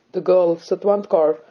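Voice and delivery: plain reading voice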